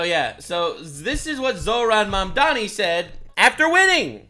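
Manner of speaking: ordinary talk, nothing marked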